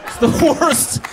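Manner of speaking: ordinary talk, nothing marked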